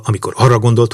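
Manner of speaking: plain reading voice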